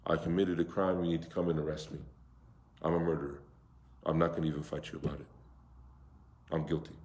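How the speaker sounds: low, subdued, resigned confession; remorseful confession. Acted. Murderer calls the police on himself, subdued remorse. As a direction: confess softly, pitch and volume dropped. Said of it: "they're remorseful from the moment".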